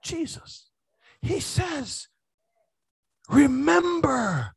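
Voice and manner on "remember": Remember drawn out slowly with a falling tune; emphasized Remember. Shown as a demonstration. Preacher stressing the scripture's command, slow drawn-out Remember. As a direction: stretch Remember long and slow, then let it fall. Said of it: "That's why I'm emphasizing this".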